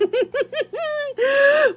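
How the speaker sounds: delighted high-pitched giggle; high giggling laugh. Real, not acted. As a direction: giggle helplessly at the toy. Laughs at SpongeBob toy.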